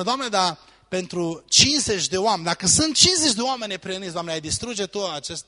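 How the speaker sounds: high, quick, eager pleading; Abraham bargaining. Retold or imitated. Preacher voicing Abraham bargaining with God, high and eager.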